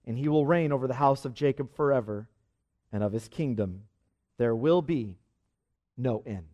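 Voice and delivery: low, measured reading of scripture. Acted. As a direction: read the promise solemnly, fading at the close. Reads scripture to congregation.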